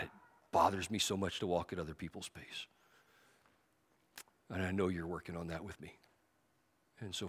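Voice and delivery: soft, low prayer voice; closing prayer. Acted. Preacher leading the congregation in prayer, soft. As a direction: pray quietly and intimately.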